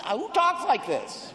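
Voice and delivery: high, drawn-out comic disbelief; incredulous protest. Retold or imitated. Actor baffled by clunky script dialogue, incredulous. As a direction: exclaim it in exasperation. Retells his exasperated reaction.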